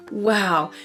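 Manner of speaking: ordinary delivery, nothing marked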